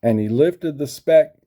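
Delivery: plain reading voice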